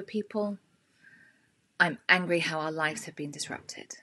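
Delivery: guided tapping statements; tapping script. Shown as a demonstration. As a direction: lead the group through each statement calmly. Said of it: "tap along with me and repeat what I say".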